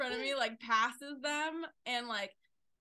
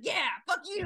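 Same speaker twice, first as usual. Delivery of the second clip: high, loud yell; gleeful yell; 9 semitones higher, 8 dB louder than usual. Retold or imitated. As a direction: fling it at them with glee. Honking back at others.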